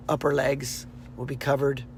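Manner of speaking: ordinary talk, nothing marked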